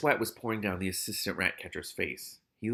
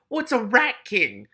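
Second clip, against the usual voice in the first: high, loud, slow question; sharp; 11 semitones higher, 8 dB louder, slower than usual. A narrator's reading. Keith asking about the confessed secret, sharply. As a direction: sharply demand an explanation. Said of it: "said Keith sharply".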